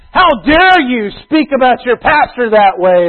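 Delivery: mock-scolding a churchgoer; indignant outburst. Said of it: "I could just fly off the wall".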